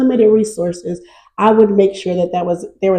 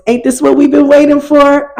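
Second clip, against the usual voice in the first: higher-pitched, hyped-up rapper imitation; 6 semitones higher, 4 dB louder than usual. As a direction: imitate a rapper hyping the crowd. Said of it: "in my [name] voice".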